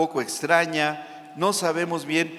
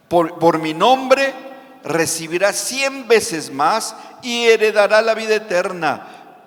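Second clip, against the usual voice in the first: preached climax; 4 semitones higher, 7 dB louder than usual. Acted.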